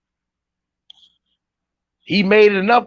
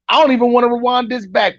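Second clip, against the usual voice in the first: ordinary talk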